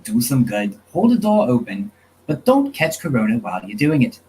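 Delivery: playful, wobbly fake Australian accent; attempted Australian accent. Host giving final thoughts to the audience, fake Australian accent. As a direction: sign off in a badly faked Australian accent. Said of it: "Hope you don't mind the accent".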